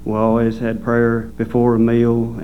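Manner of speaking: normal talking voice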